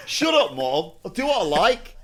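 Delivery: put-on high, loud whiny teenager; bratty drunk teen. Drunk teenager snapping back at his mum, high and whiny. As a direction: play the sulky drunk kid talking back.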